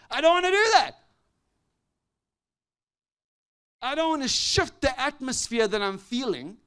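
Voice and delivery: loud, ringing pulpit voice; booming sermon delivery; full-force preaching. Real, not acted. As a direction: preach it out to the whole room. Preaching builds back to full force.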